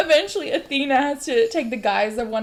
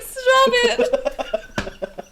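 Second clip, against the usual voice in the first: river voice; 5 dB louder than usual. Retold or imitated. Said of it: "He's like".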